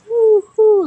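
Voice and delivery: high, loud hooting owl call. Shown as a demonstration. [name] shows how he calls the owl, hooting loudly. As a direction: hoot like an owl. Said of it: "like that".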